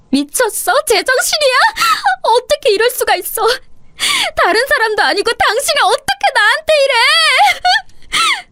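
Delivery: high, breaking voice through tears, rising to a sobbing shout; crying in disbelief. Acted. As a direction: sob it out, hurt and stunned by the slap. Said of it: "눈물이 나오기 시작했습니다". (뺨을 맞고 울부짖는 목소리)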